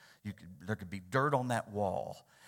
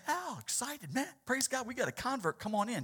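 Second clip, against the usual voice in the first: much higher, gushing mock cheer; preacher mocking what Jesus didn't say, high gush; mock excitement; 13 semitones higher than usual. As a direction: overeager convert-grabbing gush, hammed up. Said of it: "Jesus didn't say".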